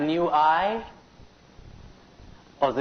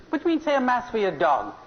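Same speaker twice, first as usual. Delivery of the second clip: high, rushed mock indignation; indignant outrage; 9 semitones higher, faster than usual. Priest answering a man's request for a dog's mass, indignant. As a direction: act out an affronted priest's quick protest, pitched up. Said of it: "Father's indignant, he's outraged".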